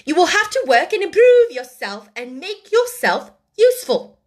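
high, loud, emphatic scolding; stern lecture. A narrator's reading. Headmistress tells the orphan to earn her keep, sternly. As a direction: drive each demand home severely.

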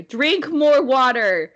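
loud, drawn-out mock command; mock angry. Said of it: "I'm, like, mad or angry".

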